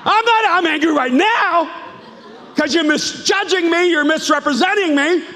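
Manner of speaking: high, loud indignant protest; mock-furious pushback at being misjudged; shouted defensive outburst, pitched high; angry denial. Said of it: "You go".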